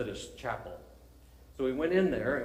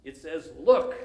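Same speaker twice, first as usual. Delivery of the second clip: high, loud, slow mock pulpit voice; hammed-up village preacher drawing it out; preacher's opening; 6 semitones higher, 9 dB louder than usual. Lay preacher opening to his chapel, high and slow. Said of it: "he began to say".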